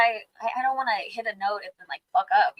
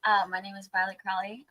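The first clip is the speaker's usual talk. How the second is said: ordinary delivery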